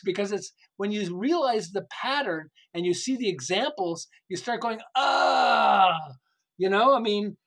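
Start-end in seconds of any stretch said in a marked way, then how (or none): 5.0-6.0 long loud high exclamation of realization; loud drawn-out aha cry; excited ah. Retold or imitated. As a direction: shout the aha moment. Voicing sudden realization.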